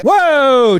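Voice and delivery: high, loud whoa; swooping cry of astonishment; hammed-up wowed reaction; imitated amazed fan. Acting a startled fan in costume.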